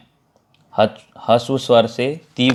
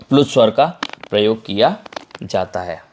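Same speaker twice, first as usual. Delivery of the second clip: ordinary talk, nothing marked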